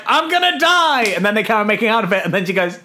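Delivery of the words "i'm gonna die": high, drawn-out dramatic declaration; mock blurted confession. Patient tells his date his fate, mock drama. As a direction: blurt it high and slow, hammed-up.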